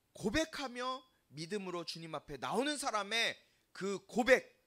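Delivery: ordinary delivery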